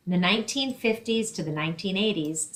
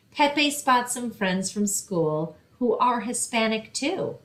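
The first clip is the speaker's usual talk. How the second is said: storytime reading. Acted. Librarian reading a picture book to children, lively storytime voice. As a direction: read it brightly to a room of kids.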